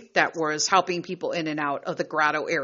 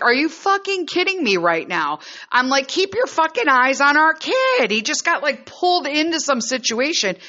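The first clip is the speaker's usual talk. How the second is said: angry at husband; 7 semitones higher than usual. Retold or imitated. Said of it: "And I'm like".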